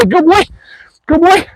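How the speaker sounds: high-pitched excited praise; real high, eager cheering to a dog. Real, not acted. Trainer praising dog in play.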